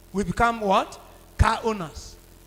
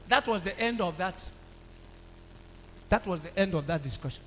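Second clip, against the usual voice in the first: ordinary delivery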